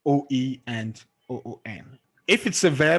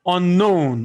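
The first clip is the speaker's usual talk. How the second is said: unknown with KNOWN loud and drawn out; stress on second syllable; 4 semitones higher, 8 dB louder than usual. Shown as a demonstration. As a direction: punch KNOWN in unknown, never UN. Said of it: "the on will never be stressed".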